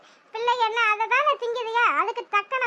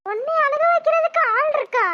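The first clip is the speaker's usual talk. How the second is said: higher and louder incredulous question; can anyone really make you cry; shocked disbelief; 5 semitones higher, 5 dB louder than usual. Acted.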